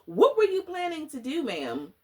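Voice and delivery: high, pushy mock interrogation; sassy demand. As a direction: press her for an answer, all sass. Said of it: "And I was like".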